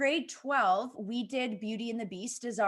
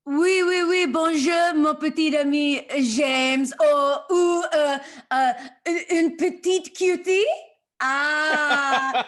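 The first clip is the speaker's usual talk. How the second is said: put-on French accent; 4 semitones higher, 9 dB louder, slower than usual. Guest answers in hammy French.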